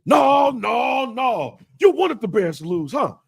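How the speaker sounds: high, loud accusing outburst; angry retold father. Father accuses son of wanting Bears to lose, high angry yell. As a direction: imitate dad shouting accusations.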